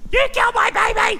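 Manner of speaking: very high, loud hammed-up shriek; mock anguished wail. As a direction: wail like a melodramatic mother. Mock outrage at a part.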